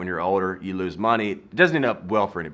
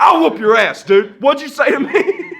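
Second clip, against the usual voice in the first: high, very loud hammed-up challenge; belligerent Southern threat; 10 dB louder than usual. Southerner challenges someone to fight, mock-furious shouting. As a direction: holler it like you're itching to brawl. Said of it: "I'm from the South, where you're just like".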